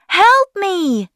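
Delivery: high, loud cry for rescue; scream for help. A narrator's reading. Young hair blown away, screaming for help. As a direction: cry out in alarm, high and drawn out. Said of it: "Harry screams".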